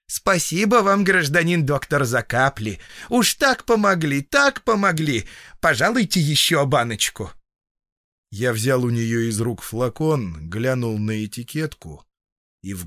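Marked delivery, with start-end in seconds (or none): 0.1-7.3 high, gushing peasant woman; butter him up in a singsong; flattering singsong. A narrator's reading. Grateful patient asking doctor for another bottle, flattering. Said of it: "запела льстиво".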